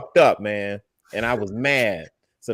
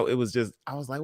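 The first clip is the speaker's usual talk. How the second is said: ordinary talk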